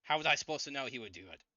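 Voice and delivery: loud, rushed protest; mock scream. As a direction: blurt it out in a half-yell. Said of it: "He starts screaming".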